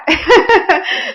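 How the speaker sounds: bright amused laugh, rising. Real, not acted. Coach laughing to her audience about the fee, amused laugh. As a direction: laugh at how cheap the fee is.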